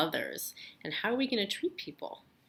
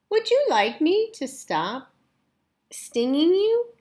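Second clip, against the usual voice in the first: higher, slow sugary question; sweet polite voice; 9 semitones higher, 6 dB louder than usual. Acted. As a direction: ask it with exaggerated courtesy. Polite bee voice.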